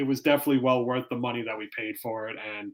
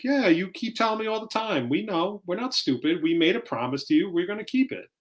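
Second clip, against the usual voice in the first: pitched up, weary mock protest; higher, fed-up dad reassuring his nagging kid; exasperated reply; 6 semitones higher than usual. Dad answering his daughter's nagging, pitched-up exasperation. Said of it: "And I'm like".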